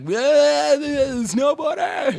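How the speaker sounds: high, slow, shouted fan gush; starstruck fan. Retold or imitated. Fans mistaking him for the athlete, high drawn-out starstruck shout. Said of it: "people are still like".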